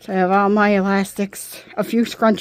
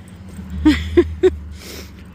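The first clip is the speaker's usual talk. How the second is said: breathy giggle; 7 semitones higher than usual. Real, not acted. Laughs at dog chasing another.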